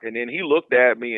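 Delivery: ordinary talk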